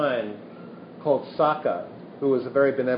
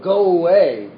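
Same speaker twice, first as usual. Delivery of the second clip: mild mock rejection; 3 semitones higher, 5 dB louder than usual. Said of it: "we throw more resistance".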